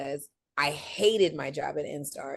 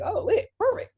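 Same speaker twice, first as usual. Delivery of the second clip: squealing much higher with delight; excited; 4 dB louder than usual. Retold or imitated. Recalling getting the job offer, squealing excited. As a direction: leap way up in pitch, thrilled. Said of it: "And I was like".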